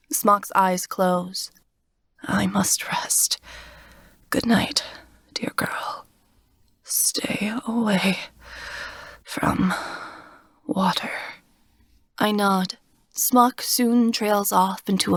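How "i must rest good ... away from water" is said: soft, slow whisper; fading whisper. Acted. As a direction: whisper it, falling asleep. Wounded Smock drifting to sleep.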